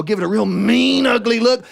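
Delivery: mock menace in the words; emphatic. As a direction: lean into mean and ugly. Hunter explains the mean look.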